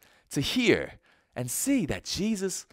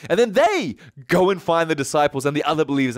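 usual speaking voice

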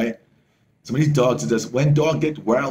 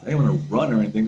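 normal talking voice

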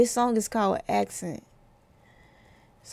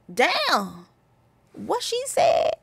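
"what she said" jumps way higher, slow and disbelieving; mock surprised reaction; 6 semitones higher, much slower than usual. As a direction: play a listener stunned by the line. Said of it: "bars that's like".

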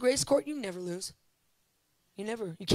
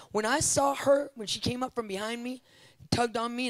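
usual speaking voice

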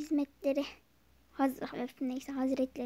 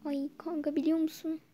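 ordinary delivery

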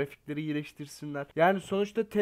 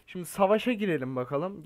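ordinary talk, nothing marked

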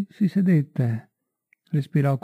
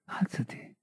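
breathy whisper, much softer; 12 dB quieter than usual. A narrator's reading. Felix murmuring to kneeling Marie, a whisper. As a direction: barely voice it, a hush. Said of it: "e mormorò".

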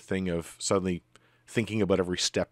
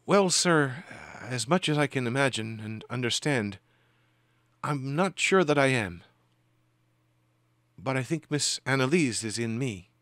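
higher-pitched, politely puzzled woman; raised, tentative voice of a polite female spirit; timid female spirit; 5 semitones higher than usual. Acted. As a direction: answer timidly and deferentially, feeling for the words. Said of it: "in a timid female voice".

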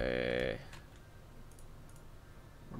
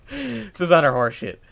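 louder, disgusted complaint; frustrated; 6 semitones higher, 9 dB louder than usual. Real, not acted. Player cursing his coaster rating, disgusted. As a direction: spit it out in disgust. After failed test.

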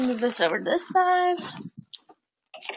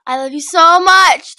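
loud; 5 semitones higher, 19 dB louder, slower than usual. Real, not acted. Calling out a shout-out.

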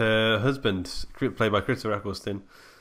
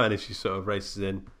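usual speaking voice

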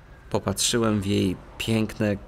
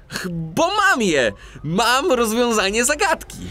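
high, eager exclamation; excited; 9 semitones higher than usual. Acted. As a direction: burst out with the discovery. Excited at solving riddle. (podekscytowany, wysoki okrzyk odkrycia)

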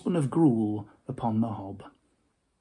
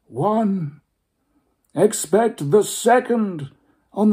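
higher, louder, slow grave spectre; Marley's ghost instructing Scrooge; solemn ghost; 7 semitones higher, 5 dB louder than usual. Acted. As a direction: intone the warning slowly. Ghost's long solemn warning.

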